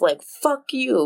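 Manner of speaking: "fuck you" drawn out slowly and pitched high; slow emphatic fuck you. Retold or imitated. Podcaster quoting a TikTok rant, slow high emphasis. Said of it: "that TikTok where it's like".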